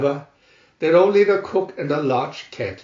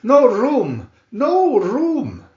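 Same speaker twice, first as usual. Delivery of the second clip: raised, higher-pitched storybook cry; 7 semitones higher, 5 dB louder, much slower than usual. A narrator's reading. March Hare and Hatter warn off approaching Alice, crying out. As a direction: call it out high and loud. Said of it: "they cried".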